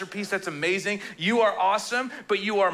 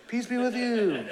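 a touch of a mellow hippie, laughing; laid-back hippie voice, half-joking; hippie greeting; 5 semitones higher than usual. Retold or imitated. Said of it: "they're all hippies".

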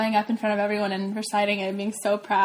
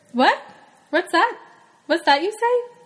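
rising, higher questioning; sudden surprise; 6 semitones higher than usual. Acted. Sick child hears it is Saturday, acted sudden surprise. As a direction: perk up in acted disbelief, pitch climbing.

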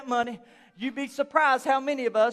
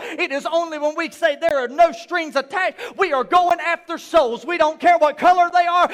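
louder, higher fiery preaching; full-force preaching; 4 semitones higher, 7 dB louder than usual. Acted. Pastor preaching hard to his congregation, loud and rising. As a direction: drive it home to the congregation.

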